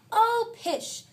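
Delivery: high, loud scoffing mother; dismissive scoff. Acted. Mother dismisses the teasing with a high, loud scoff. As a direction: brush the teasing off.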